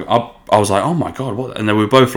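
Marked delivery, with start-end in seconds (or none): 0.8-1.6 higher, softer startled gasp of words; shocked. Retold or imitated. Friend spotting friends at the airport gate, mildly shocked. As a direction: imitate being taken aback, pitch up. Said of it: "pretty shocked".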